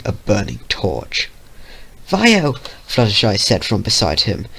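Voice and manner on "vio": small timid voice, pleading; timid pony voice. Acted. Fluttershy pleading with Vio.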